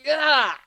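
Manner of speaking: sharp, loud, high cry of pain. Acted. Snape kicked by Sirius, sharp loud cry. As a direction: cry out as the kick lands. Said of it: "Snape says again".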